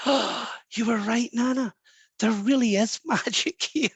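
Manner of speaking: gasping, drawn-out child's wonder, imitated; awestruck little boy. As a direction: act a child amazed at finding magic. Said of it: "the little boy said to his nana".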